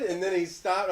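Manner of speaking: usual speaking voice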